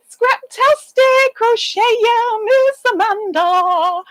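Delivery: high, slow drawn-out greeting; sing-song greeting. Real, not acted. Greeting a viewer by name in a high sing-song. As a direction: call out her name in a high slow lilt.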